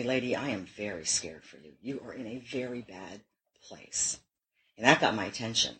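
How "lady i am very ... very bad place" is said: hushed, much softer, serious officer's warning; grave quiet warning. Retold or imitated. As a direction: lower the voice right down as if breaking bad news. Police officer warning her gravely.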